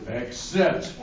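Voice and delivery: 三回目の except を低く引き伸ばしてためる; except, said low and drawn out for suspense; drawn-out dramatic except. Shown as a demonstration.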